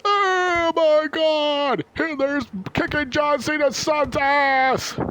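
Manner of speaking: very high, loud, drawn-out amazement; mock amazed fan. Stunned fans watching Hitler win the fight, very high and slow.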